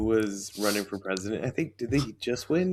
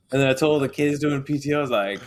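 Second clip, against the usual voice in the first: usual speaking voice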